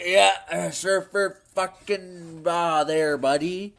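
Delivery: lightly voiced laid-back surfer drawl; a hint of a surfer bro; PPP surfer voice. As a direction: mimic a streamer's inflection. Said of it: "P P P talks like that".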